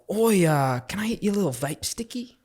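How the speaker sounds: high, fast Aussie bloke voice; Australian accent. Retold or imitated. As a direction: ask for the vape like an Aussie. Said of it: "talking in Australian accents".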